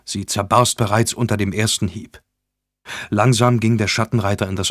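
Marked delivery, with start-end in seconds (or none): none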